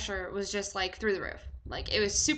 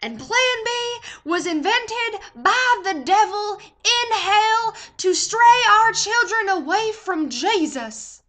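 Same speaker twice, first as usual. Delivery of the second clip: loud, high, slow fire-and-brimstone sermon; hammed-up preacher stretching every damning word; radio preacher; 9 semitones higher, 9 dB louder, slower than usual. Radio guy warning listeners about Plan B, mock thundering preacher. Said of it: "The guy on the radio goes".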